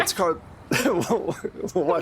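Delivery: slow, high, giggling through the words; trying to talk through real laughter; laughing mid-word. Real, not acted. Game master cracking up while naming the drug, laughing. GM loses it, forgets drug name.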